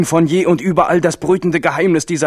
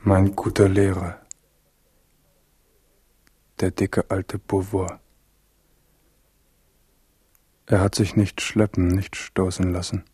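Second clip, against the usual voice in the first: leise, tiefere Gedankenstimme mit Hall; innere Stimme; 7 semitones lower, 6 dB quieter than usual. A narrator's reading. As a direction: wie ein stiller Gedanke im Kopf. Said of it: "Das Erste, was der wieder erwachte Henri dachte". (leise innere Stimme, nach innen gesprochen)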